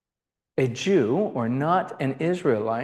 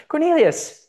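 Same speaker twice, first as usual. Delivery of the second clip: squeaky-high, louder angel call; high-pitched angel voice; 12 semitones higher, 5 dB louder than usual. Acted. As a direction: call the name way up high, as a joke. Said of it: "maybe he's got a high-pitched voice".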